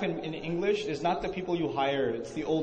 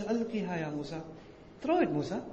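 softer, gentle "ألقها يا موسى"; gentle command "ألقها يا موسى" spoken softly; 5 dB quieter, much faster than usual. Shown as a demonstration. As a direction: say it softly, kindly. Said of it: "But Allah says".

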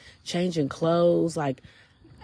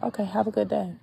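higher, softly said brush-off with a touch of smirk; smirking politeness; 5 semitones higher, 4 dB quieter than usual. Retold or imitated. Answering someone's attitude without cussing her out, lightly smirking. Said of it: "I just would smirk and be like".

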